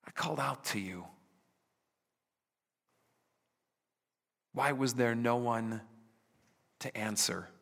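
soft, hushed bewilderment; quietly wounded, searching for them; bewildered God. Acted. God asking his people where they were, hushed and bewildered. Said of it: "in utter bewilderment".

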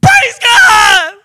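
high ecstatic cry of "Praise God!"; shouting praise. Retold or imitated. Healed leper shouting thanks to God, high loud cry. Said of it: "He was shouting".

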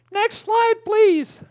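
high called-out request; calling to the booth. Real, not acted. Pastor calling to slide operator: high called request. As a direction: sing out to the tech booth.

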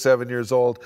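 ordinary talk

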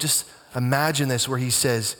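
usual speaking voice

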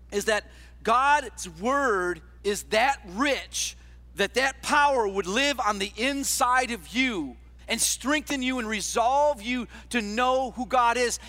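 normal talking voice

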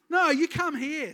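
loud, high, slow retort; exasperated mother reply. Retold or imitated. Mother answering nagging child, loud mock exasperation. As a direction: snap back at the child, loud. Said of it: "It's like".

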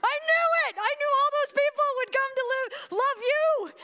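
high-pitched, shouted tantrum; angry Jonah. Acted. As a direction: rage at God with a loud I-told-you-so. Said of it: "no, he's angry".

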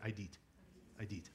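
quiet call-and-response Idit; repeat after me. Shown as a demonstration. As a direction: model Idit for them to echo. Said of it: "Can you just say that?".

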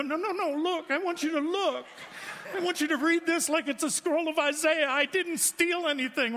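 high pitched frantic plea, voice jumped way up; frantic pleading. Pretend. Pastor begging Costco door checker to read his receipt, high frantic plea. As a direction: squeak out a desperate plea to be checked.

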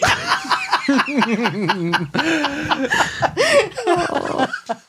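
long, high burst of laughing; hearty laughter. Real, not acted. Woman joking she is the better version now, real hearty laughter. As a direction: crack up at your own joke. Laughing at her own story.

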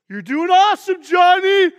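high, loud sideline shout; cheering from sidelines. Retold or imitated. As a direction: yell encouragement across the field. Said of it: "I would be like".

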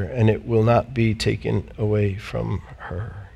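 low, soft and tender rebuke; Jesus gently correcting an overworked Martha, low and tender; gentle voice of the Lord. Acted. Voicing the Lord's gentle answer.

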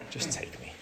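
whispered, hopeless plea; despairing whisper. Retold or imitated. Preacher voicing Jonah, whispering his wish to die. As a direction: breathe it out like you've given up.

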